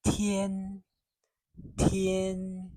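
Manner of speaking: Tian held high and level; first tone Tian. Shown as a demonstration. Teacher demonstrates Tian to students, high steady tone. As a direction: sing Tian on one high flat pitch. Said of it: "you'll say that it's a first tone".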